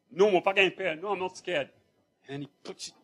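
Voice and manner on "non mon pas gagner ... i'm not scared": put-on louder, higher boyish denial; defiant denial. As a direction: deny it hard, half-laughing. Boy answers in Creole, defiant.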